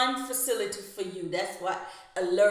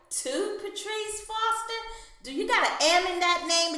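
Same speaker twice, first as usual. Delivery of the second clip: incredulous; 9 semitones higher than usual. Retold or imitated. Incredulous questioning.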